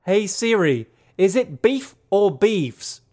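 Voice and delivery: put-on slow, high Australian accent. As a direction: ask Siri in an Australian accent. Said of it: "ask it in an Australian accent".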